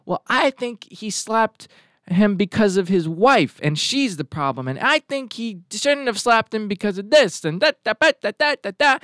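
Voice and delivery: mock opinionated bickering. Mimicking everybody's social media opinions on the slap, mock bickering. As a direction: mimic know-it-alls, sliding into da-da babble.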